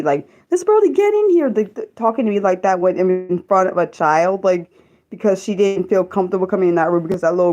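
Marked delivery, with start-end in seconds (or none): 0.5-1.5 high-pitched mock yell; retold yell, pitched way up; imitated yell. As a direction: imitate being yelled at from across a room. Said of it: "she yelled at me".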